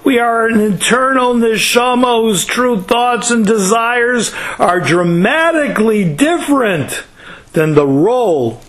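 fervent preacher building to the lesson; impassioned preaching. Real, not acted. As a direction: drive the moral home to the congregation. Preaches the moral with rising fervour.

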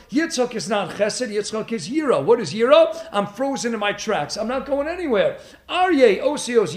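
usual speaking voice

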